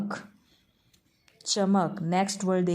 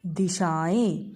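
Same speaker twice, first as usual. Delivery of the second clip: slow dictation; much slower than usual. Shown as a demonstration. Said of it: "तो वो हमारा बन गया".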